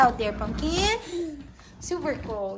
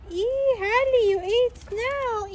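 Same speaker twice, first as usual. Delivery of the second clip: higher, slowed mock-dismay; playful shock; 8 semitones higher, slower than usual. Real, not acted. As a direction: tease the dog for eating snow.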